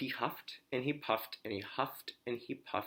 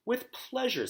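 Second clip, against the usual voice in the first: much higher, sweet flamingo voice; gracious flamingo; 13 semitones higher than usual. Acted. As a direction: chirp it high and polite. Flamingo answers politely.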